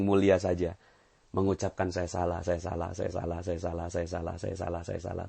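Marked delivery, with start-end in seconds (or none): 1.9-5.2 murmured self-blame. Shown as a demonstration. Models repeated humble self-blame.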